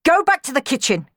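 sharp, forceful command. A narrator's reading. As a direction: order a servant away. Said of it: "commanded the Baron".

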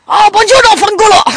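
loud, high mock-Italian outburst. Joking to co-host about the bird's Italian name, mock Italian. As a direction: shout it like a cartoon Italian. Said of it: "and the Italian one is".

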